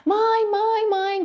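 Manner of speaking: high, urgent mock yell calling mother; imitating a child screaming for mom in the night; a girl calling her mother in fright, retold high and urgent. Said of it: "eu comecei a gritar".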